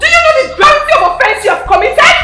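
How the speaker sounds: loud, high and cutting; furious scolding. Acted. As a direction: lay down the royal law. Princess scolds the driver.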